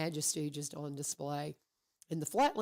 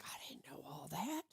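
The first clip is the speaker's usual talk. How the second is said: hushed, voiceless aside of surprise; amazed whisper; 11 dB quieter than usual. Retold or imitated. Woman recalling what she learned about her friends, amazed whisper. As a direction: whisper it as a private revelation.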